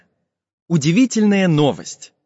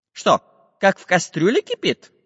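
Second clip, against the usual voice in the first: higher, puzzled iron man; puzzled questioning; 6 semitones higher than usual. Acted. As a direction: ask back in disbelief. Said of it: "переспросил Самоделкин".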